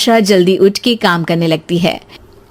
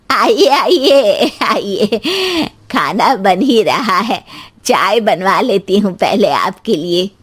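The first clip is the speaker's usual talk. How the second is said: slow, chuckling elder voice; mother-in-law welcoming guests; slower than usual. Acted. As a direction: usher guests in with drawn-out words.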